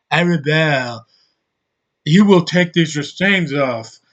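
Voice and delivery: slow, put-on British accent; mock British accent. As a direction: imitate a British movie line with a mocking accent. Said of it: "That accent just kills me".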